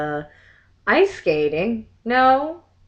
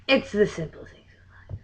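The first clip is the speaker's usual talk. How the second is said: whispered wry aside; softly whispered punchline comment; 6 dB quieter than usual. Real, not acted. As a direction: whisper it to yourself, amused. Hushed aside after a joke.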